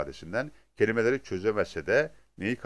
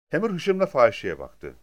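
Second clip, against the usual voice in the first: plain reading voice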